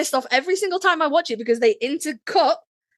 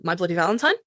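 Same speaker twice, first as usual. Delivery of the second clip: ordinary delivery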